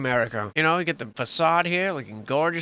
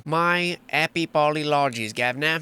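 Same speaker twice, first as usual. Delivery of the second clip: slow, hammed-up British apology; mock British accent; slower than usual. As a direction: draw it out syllable by syllable in mock British. Apology repeated as mock Brit.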